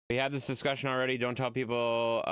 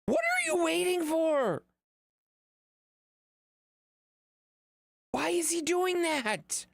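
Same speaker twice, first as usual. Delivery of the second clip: high slow exasperation; 3 dB louder, slower than usual. Real, not acted. Exasperated at the dog.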